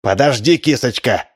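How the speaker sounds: usual speaking voice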